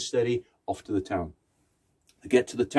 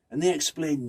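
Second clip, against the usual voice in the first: usual speaking voice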